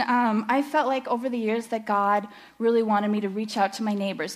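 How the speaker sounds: ordinary talk, nothing marked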